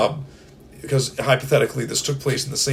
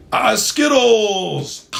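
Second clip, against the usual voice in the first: high, drawn-out shout; slow high shout of Skittles; triumphant shout; 9 semitones higher, 6 dB louder, much slower than usual. Pretend. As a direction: announce Skittles as if it's a battle cry. Triumphant answer to the mob.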